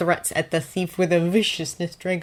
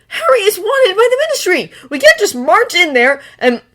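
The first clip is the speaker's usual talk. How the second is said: heated arguing; 11 dB louder than usual. A narrator's reading. Draco keeps arguing.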